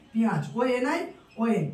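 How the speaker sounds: ordinary talk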